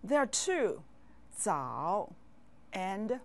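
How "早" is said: "早" dipping low, then coming up; Mandarin third tone. Shown as a demonstration. Teacher showing learners a third-tone word, "早" low and dipping. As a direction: drop "早" deep into the voice and let it rise. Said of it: "How many words have the third tone".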